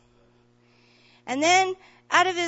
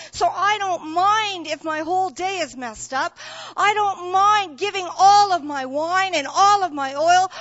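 louder and higher, voice catching with tears; an impassioned promise on the edge of tears; tearful vow; 6 semitones higher, 9 dB louder than usual. Acted. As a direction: plead it as if close to crying. Samaritan's inner vow, voice cracking.

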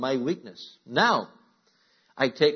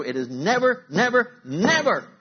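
never climbing higher and louder each time; emphatic never; 9 semitones higher, 5 dB louder than usual. Real, not acted. Preacher stressing the Hebrew never to the church. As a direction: hammer the never up to a cry. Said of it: "It's emphatic".

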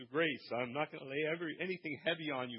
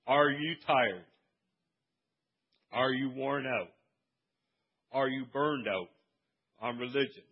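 louder rhetorical preaching; preaching questions; 2 semitones lower, 5 dB louder than usual. Acted. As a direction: press each question on the crowd.

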